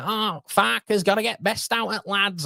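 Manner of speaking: high-pitched Mancunian impression; proper Mancunian. Host imitating a Leeds fan from Rochdale, proper Mancunian. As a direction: put on a thick Mancunian accent, pitched well up. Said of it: "talking like that, proper Mancunian".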